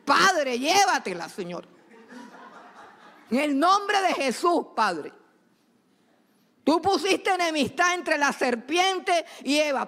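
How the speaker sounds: oración intensa y aguda, en tono de guerra espiritual; oración de madre al escuchar el noviazgo. Retold or imitated. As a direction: clama con autoridad contra el noviazgo del hijo. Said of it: "yo me enteraba y no decía nada". (madre imitándose orando contra la novia del hijo, tono agudo e intenso)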